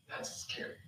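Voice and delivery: barely audible, voiceless whisper; hushed whisper. Real, not acted. Preacher to congregation on Allah's response, hushed whisper. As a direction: whisper it in dread. Awed reaction before repeating it aloud.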